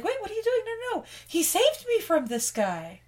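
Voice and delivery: pleading, very high and breathless; high princess voice. Retold or imitated. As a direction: squeal it like a frantic princess.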